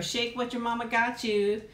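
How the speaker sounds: usual speaking voice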